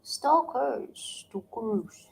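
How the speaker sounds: "Stokers" in a Filipino accent. Shown as a demonstration. Wife asked to say a word, "Stokers" in a Filipino accent. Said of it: "You say the word stokers".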